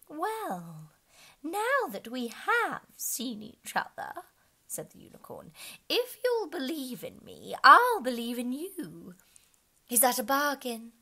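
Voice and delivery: high, slow creature voice; unicorn voice. Acted. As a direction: offer the bargain slowly and high. Unicorn voice in story.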